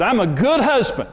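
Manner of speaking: husband shouting his case at his wife in the car; retold yelling. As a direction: yell it back defensively, mildly mocked. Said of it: "I was yelling at Karen".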